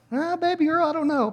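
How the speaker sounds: high-pitched, wavering protest; mock reluctant dad. Dad answering his pleading daughter, hammed-up reluctance. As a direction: squirm out of it in a high voice.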